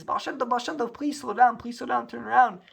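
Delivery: high, urgent call after someone far ahead. Acted. Yaakov chasing the Baal Shem Tov, calling out urgently. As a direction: shout to make him turn around.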